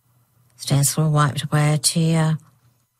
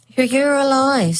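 cheering; 9 semitones higher than usual. Acted. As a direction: burst out in relief. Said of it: "Stanislaw cheered".

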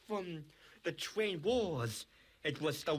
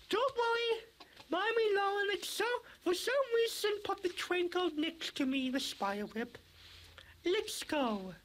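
high character voice. Acted. Child voices Poppy character.

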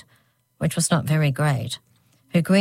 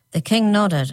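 ordinary delivery

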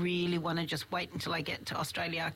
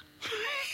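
high squealing laugh. Real, not acted.

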